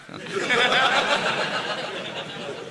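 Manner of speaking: high, airless laughter; breathy laugh. Real, not acted. Speaker laughing at his own joke to the listeners, high and breathy. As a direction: let the laugh escape breathily.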